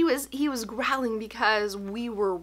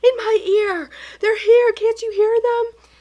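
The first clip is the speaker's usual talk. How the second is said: put-on higher, louder, pleading child voice; frightened child; 12 semitones higher, 9 dB louder than usual. Son begging his mother to hear the voices, high and frightened. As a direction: plead like a scared little boy.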